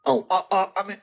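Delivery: usual speaking voice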